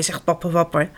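ordinary delivery

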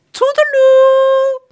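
very high, loud, slow sing-song farewell; cheeky goodbye. Acted. Robin waving to the prince over the fence, cheeky. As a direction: sing it teasingly.

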